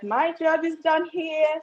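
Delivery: high, slow, lordly pronouncement; mock grand declaration. Wife tells husband she is done after cooking, slow and mock-grand. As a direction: announce your duty is finished like royalty.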